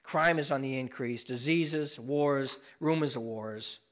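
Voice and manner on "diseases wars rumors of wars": ordinary talk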